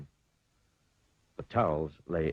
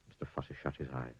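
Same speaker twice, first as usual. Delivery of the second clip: ordinary delivery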